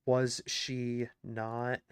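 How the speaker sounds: ordinary talk, nothing marked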